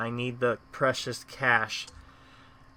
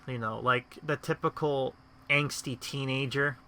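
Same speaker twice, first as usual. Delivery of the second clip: usual speaking voice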